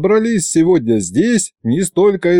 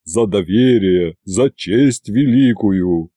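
deep, slow speech of thanks; drawn-out, low and grateful; Ivan's moved bass; 6 semitones lower, slower than usual. A narrator's reading. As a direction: address the crowd in a low, touched bass. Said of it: "растроганно пробасил Иван".

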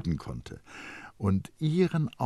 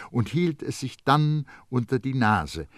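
plain reading voice

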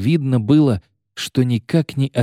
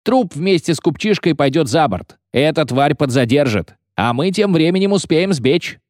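high, pressing voice; agitated argument; 7 semitones higher than usual. A narrator's reading. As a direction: urge the plan on the others. Mutineer argues excitedly. (возбуждённо, напористо)

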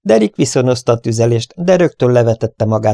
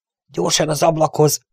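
higher, urgent call; urgent shout; 6 semitones higher than usual. Acted. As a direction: hurry him to the window. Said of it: "kiáltott rá Steve".

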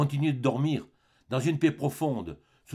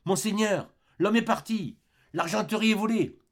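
voix haute et affolée de servante; bouleversée; 11 semitones higher, 4 dB louder than usual. Acted. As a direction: annonce le vol, paniquée. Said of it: "toute bouleversée". (servante affolée, voix aiguë)

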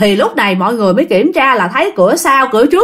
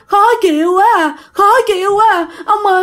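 crying plea; 7 semitones higher than usual. Retold or imitated. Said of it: "mà ổng chỉ rên thôi".

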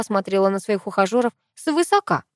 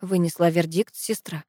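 plain reading voice

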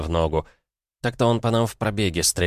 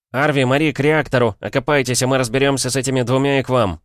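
raised, louder voice barking orders; quick bark; 6 semitones higher, 4 dB louder than usual. A narrator's reading. Pilot sends Арви and Мари to the reactor, quick bark. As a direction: fire off urgent commands, higher and loud. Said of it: "быстро рявкнул я".

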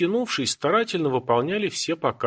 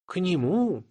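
higher-pitched incredulous question; surprised; 7 semitones higher, slower than usual. A narrator's reading. Old woman doubts the boy as teacher, surprised. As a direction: query it in mild surprise. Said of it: "удивлённо ткнула пальцем".

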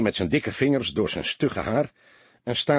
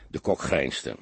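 ordinary delivery, nothing marked

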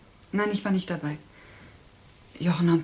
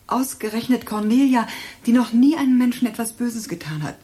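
higher, louder anguished outburst; 5 semitones higher, 7 dB louder than usual. Acted. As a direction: burst out in despair over her sister. Verzweiflung über Cornelias Tod. (verzweifelter Ausbruch)

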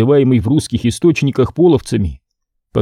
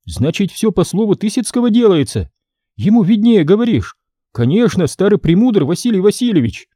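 put-on sarcastic boy prince; 8 semitones higher than usual.

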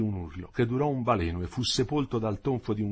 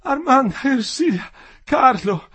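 high, loud, desperate cries, stammering; delirious; 14 semitones higher, 9 dB louder than usual. A narrator's reading. Dying worker calling for his family, delirious. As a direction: cry out the names in mounting frenzy. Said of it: "Ora già delirava".

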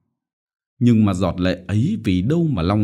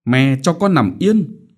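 slow, louder peevish plea; sulky; 6 semitones higher, 5 dB louder than usual. A narrator's reading. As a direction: shrug her off sulkily. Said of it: "Cô nguẩy một cái". (nguẩy nũng nịu)

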